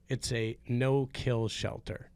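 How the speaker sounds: ordinary delivery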